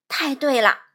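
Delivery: loud, pleased exclamation; loud agreement. A narrator's reading. Little man gladly owning up, loud. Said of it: "小个子男人大声说".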